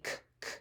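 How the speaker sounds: "K, K" as short soft voiceless clicks; just the hard k sound, quietly; letter sound k. Shown as a demonstration.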